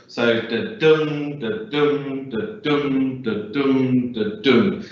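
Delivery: steady heartbeat pulse, unstressed then stressed; da dum rhythm. Shown as a demonstration. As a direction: tap out the heartbeat, weak then strong. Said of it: "heartbeat style rhythm".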